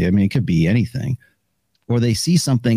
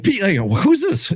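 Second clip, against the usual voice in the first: high, light who's this; puzzled phone answer; 9 semitones higher than usual. Retold or imitated. Answering a call from an unknown friend, a high puzzled hello. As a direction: pick up puzzled.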